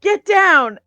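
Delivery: sharp, high, loud shout; urgent battle cry; yelled warning. Acted. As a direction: yell it to make everyone duck. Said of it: "Saldana yells".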